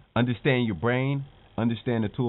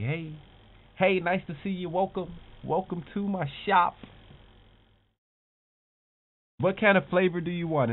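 higher, cheerful shopkeeper welcome; friendly shop greeting; 6 semitones higher than usual. Pretend. Shop owner welcoming a customer, higher and cheerful. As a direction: greet the customer like a beaming ice cream seller. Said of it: "You're like".

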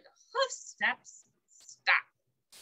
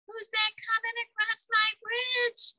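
much higher timid question; nervous ogre; 11 semitones higher than usual. A narrator's reading. Little ogre asking the bigger goat. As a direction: ask in a small high voice. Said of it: "he asked".

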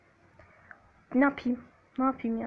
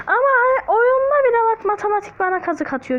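mock crying whine; 9 semitones higher, 6 dB louder, faster than usual. Whining the game cheats him.

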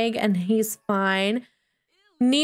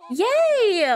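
high, drawn-out cheer; celebratory cheer; 10 semitones higher than usual. Real, not acted. Player cheering for Bria, celebratory cheer. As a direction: let out a high squeal of celebration.

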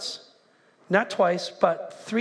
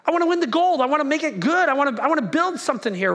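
raised, driven declaration of ambition; eager team voice; 6 semitones higher, 4 dB louder, faster than usual. Acted. Team member declaring the season's goal, fired-up. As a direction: voice a hungry competitor, pitched up. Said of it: "Everybody says".

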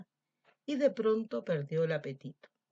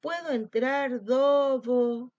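calling out; 5 semitones higher, 8 dB louder, much slower than usual. Acted. Lamb calling outside door.

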